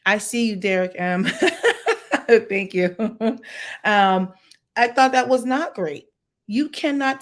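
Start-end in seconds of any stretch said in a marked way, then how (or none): 1.3-2.4 bright high burst of laughter; real laugh. Real, not acted. Host reacting to listener Derek, a high real laugh. 3.0-3.8 real laugh. Real, not acted. Laughing after thanking listener.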